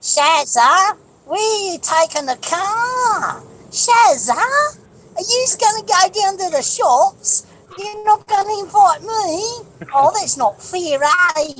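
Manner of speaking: very high, drawn-out Western Sydney whine; Western Sydney accent. Acted. Calling Shazza about the car, whiny Western Sydney accent. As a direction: wail it slowly in broad Western Sydney bogan. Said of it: "you go up in Western Sydney".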